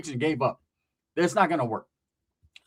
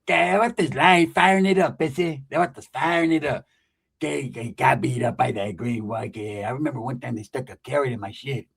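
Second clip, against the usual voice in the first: lightly voiced paranoid cousin; 4 semitones lower than usual. Pepe paranoid rambling voice.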